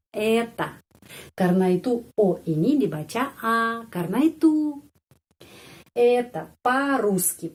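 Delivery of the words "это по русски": по-русски slowly with stress on ру; slow stress demo. Shown as a demonstration. Teacher showing stress on ру to learners. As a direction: model Это по-русски slowly. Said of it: "По-русски penekanannya di sini".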